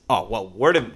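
normal talking voice

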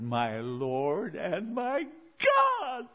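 slow, rising cry of worship; awed exclamation. Acted. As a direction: draw it out, climbing high on God. Quotes Thomas's exclamation of faith.

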